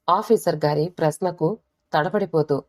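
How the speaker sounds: plain reading voice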